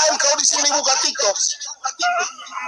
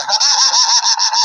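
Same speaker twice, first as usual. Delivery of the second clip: short derisive laugh; mocking laugh; 3 dB louder than usual. Real, not acted. Pastor laughing at his rival after the insults, mocking laugh.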